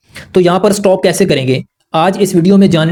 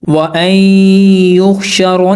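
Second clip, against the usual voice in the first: Quran recitation; slower than usual. Shown as a demonstration. Teacher reciting the verse for students as a demonstration. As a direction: recite वअन युहशरन्नासु with ghunna, measured. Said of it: "तो कैसे पढ़ेंगे?".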